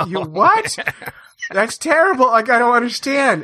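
high, appalled outburst; retold horror, voice pushed high; horrified. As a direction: recoil in disbelief. Reacting to duckling hook method.

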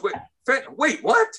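light mock shock; surprised double-take. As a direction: catch the word and do a double take. Attendant hears fentanyl, double-takes.

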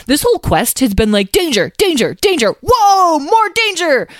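high, hammed-up panic, building; mock alarm. Lyle mocks how dangerous the quest was, exaggerated alarm. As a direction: exaggerate each repeat with climbing pitch.

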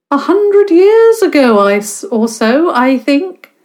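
very high, loud airy guess; theatrical vague answer. Acted. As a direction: wave the question away grandly.

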